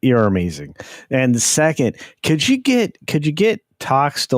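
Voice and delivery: excited shouting. Real, not acted. Cheering the fan's clip.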